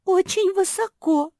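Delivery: high, loud wail of fear; frightened call. A narrator's reading. Марсия up in the leaves, frightened high cry. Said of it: "долетело из листвы".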